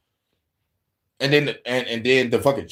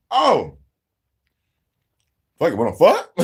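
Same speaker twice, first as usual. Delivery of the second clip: pitched up and louder, blurting it out; mock exasperated proposition; 4 semitones higher, 5 dB louder than usual. To his girlfriend after every plan is refused, exasperated blurt. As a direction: throw it out like a last desperate idea. Retold frustrated plea to girlfriend.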